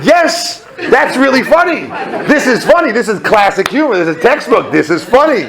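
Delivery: high, emphatic comic outburst; emphatic insistence. Acted. Intern defending his prank to HR, mock-indignant insistence. Emphatically answering HR's question.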